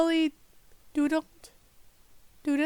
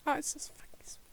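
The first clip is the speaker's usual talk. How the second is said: fast unvoiced whisper; hurried whisper; muttered to self; 11 dB quieter, much faster than usual. Real, not acted. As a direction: mumble it quickly to yourself. Frustrated muttering.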